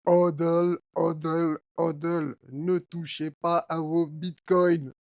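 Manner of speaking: mock commanding indicator voice, slow at the end. The indicator telling holders to keep their bitcoins, mock commanding. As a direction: play the chart indicator ordering viewers to hold. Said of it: "l'indicateur vous dit juste".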